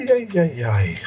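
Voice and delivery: normal talking voice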